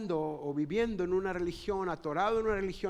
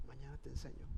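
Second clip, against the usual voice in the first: soft promise; 6 semitones lower, 11 dB quieter, faster than usual. Retold or imitated. Father's gentle promise at night.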